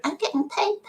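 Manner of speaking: high, small put-on child voice; imitating daughter. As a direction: squeak it out like a kid making an excuse. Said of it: "she went".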